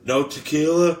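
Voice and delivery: punchy wrestler impression; Stone Cold Steve Austin. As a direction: call it out for the crowd's response. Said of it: "this is your boy, Stone Cold Steve Austin".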